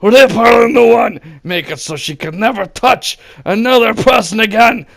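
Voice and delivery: a hint of a sinister mentor; egging on. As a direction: urge yourself on like a dark master. Cheering himself on.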